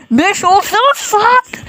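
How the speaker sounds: high, drawn-out pleading wail; whiny, long-drawn wish; desperate plea. Real, not acted. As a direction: stretch it out high, begging for time. Desperately wishing for the hour to end.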